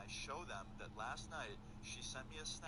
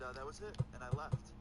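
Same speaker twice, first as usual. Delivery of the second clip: ordinary talk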